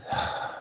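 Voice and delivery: soft, breathy sigh out; audible release of air; demonstrated exhale. Shown as a demonstration. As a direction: model a calming breath for someone.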